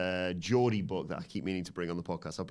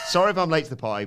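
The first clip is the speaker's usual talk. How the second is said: louder, higher second read of the chat message; louder reread; 8 semitones higher, 8 dB louder, faster than usual. Shown as a demonstration.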